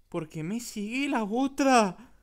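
slow question swelling to a loud cry; hammed-up bewildered wail; exasperated alarm. Gamer followed by another player, exasperated outcry.

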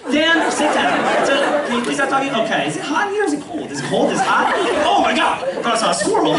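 rapid-fire chatty teacher; teacher impression. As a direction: rattle it off like a fast-talking teacher. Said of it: "could talk faster than anyone I've ever met".

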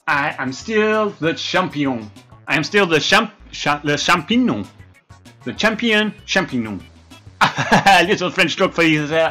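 normal talking voice

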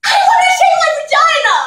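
high, very loud outburst; furious shout. Acted. Woman yelling in marital therapy, furious shout. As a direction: yell it fast at full force.

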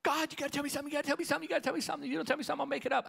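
high, urgent begging to God; pleading prayer. Retold or imitated. Preacher reliving his prayer, a high pleading cry. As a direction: beg God for a word, pitched high. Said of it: "I knock on the window".

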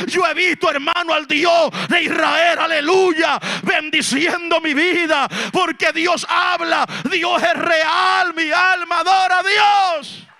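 loud, high-pitched Pentecostal sermon; full-force preaching. Real, not acted. Preacher proclaiming Jesus as Son of God to the congregation, full-force preaching. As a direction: proclaim it forcefully to the whole church.

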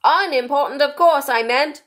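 high, loud kingly voice; King correcting himself. A narrator's reading. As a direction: correct yourself in a ringing royal voice. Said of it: "the King hastily said".

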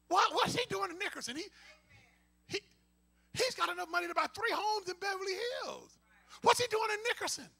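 high-pitched, incredulous onlooker; high mock disbelief; mock puzzled questioning. Voicing the congregation's puzzled judgement.

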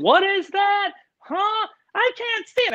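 high squeaky imitation of confused parents; mock shrill parents. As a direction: parents yelling at the host over a Zoom call, high mocking voices. Parents yelling on Zoom, mocked.

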